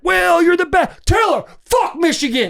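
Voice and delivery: put-on high, hyped drunk-fan shouting; a fan yelling praise in a stadium, high and hyped; rowdy fan cheer. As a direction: mimic rowdy fans cheering him on.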